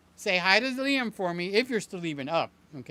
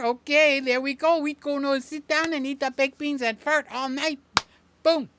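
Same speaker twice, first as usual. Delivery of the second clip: hammed-up Mexican accent, high and loud; loud joking Mexican accent; mock Mexican accent; 7 semitones higher, 6 dB louder than usual. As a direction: keep the cartoon Mexican accent going. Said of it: "say it like a good old Mexican".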